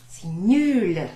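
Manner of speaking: loud, scornful put-down; mock disgust. Shown as a demonstration. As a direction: sneer it at the class. Adjektiv abfällig vorgespielt.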